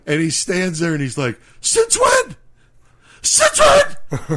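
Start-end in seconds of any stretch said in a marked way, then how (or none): 1.6-4.3 much higher, louder mock shrill yell; high-pitched shrill voice. Imitating angry Lenny to his buddies, shrill and high. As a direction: squeal it like a furious friend. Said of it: "the high pitch fucking voice".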